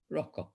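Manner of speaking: Rakshak said rokkhok with an O; casual Bengali Rakshak, rokkhok; Bengali pronunciation. Shown as a demonstration. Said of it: "If it's Bengali or just talking".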